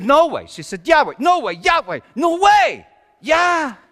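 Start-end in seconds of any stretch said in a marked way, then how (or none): 0.0-0.5 high, loud burst of disbelief; disbelieving shout. Acted. Exchange escalates into disbelieving shouts. 0.9-3.8 high, loud back-and-forth bickering; volley the words louder and higher; escalating argument shout. Acted. Rapid quarrel escalating into shouting.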